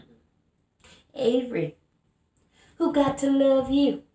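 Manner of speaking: strong-willed woman confronting a man, building then sinking; Bernice monologue. Acted. Bernice challenging Avery about being a woman without a man. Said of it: "playing the character of Bernice".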